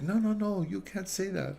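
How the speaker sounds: high, loud mock outraged lady; scolding lady. The offended Greek lady scolding him, high. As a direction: imitate her shocked scolding. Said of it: "She's like".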